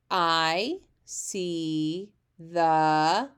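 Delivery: each word drawn out very slowly; slow pointed reading. Shown as a demonstration. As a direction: read word by word, very slowly, tapping along. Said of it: "tap with your finger with me".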